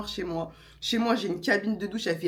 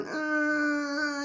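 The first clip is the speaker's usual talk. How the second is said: long slow pushing grunt, higher; straining grunt; 7 semitones higher than usual. Retold or imitated. Woman straining to push, long drawn grunt.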